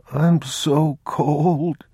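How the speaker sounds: put-on high, very slow sick child; a feverish boy complaining of cold; shivering boy. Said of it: "he said".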